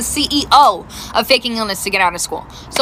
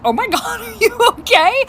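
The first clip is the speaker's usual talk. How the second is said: high-pitched question broken by laughter; burst out laughing; 8 semitones higher than usual. Retold or imitated. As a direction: imitate cracking up while asking. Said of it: "I burst out laughing".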